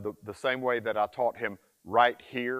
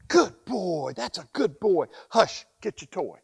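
higher, louder happy praise; praising; 7 semitones higher, 5 dB louder than usual. Shown as a demonstration. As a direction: reward him with bright praise. Praising the puppy after quiet.

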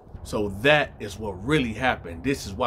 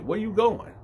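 high suspicious question; 10 semitones higher than usual. Retold or imitated. Son confronting girl.

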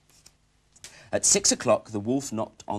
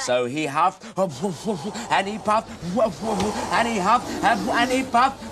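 rising higher and louder; building excitement; 11 semitones higher, 8 dB louder than usual. Acted. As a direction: build the huffing to a climax. Narration builds huffing.

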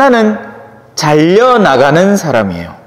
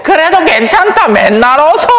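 put-on much higher, fond old lady's insistence; mock grandmother coaxing the priest, higher; elderly parishioner insisting; 9 semitones higher than usual. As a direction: insist sweetly like an old lady.

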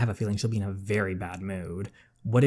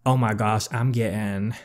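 normal talking voice

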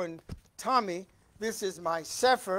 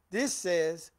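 ordinary talk, nothing marked

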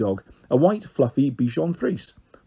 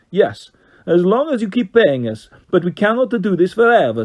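Carlos, Italian voice; 6 semitones higher, 4 dB louder than usual. A narrator's reading. Carlos bargains.

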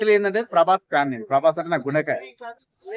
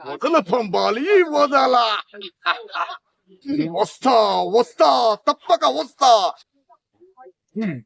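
menacing ghost villain; 6 semitones higher than usual. Acted. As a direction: sneer it like a vengeful spirit. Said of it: "Arundhati cinema dialogue".

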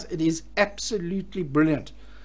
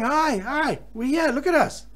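higher, playful little fish calling out; cheeky fish voice; 6 semitones higher than usual. Retold or imitated. Angler voicing fish on his sonar screen, playful higher voice. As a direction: tease like a fish showing off. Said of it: "The fish are all going".

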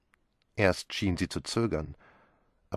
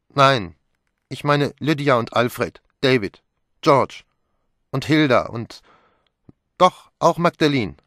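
higher, louder insistent listing; heated protest; 6 semitones higher, 10 dB louder than usual. A narrator's reading. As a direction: insist hotly, naming each one. Pilar protests, listing family members. (erregt und laut aufzählend)

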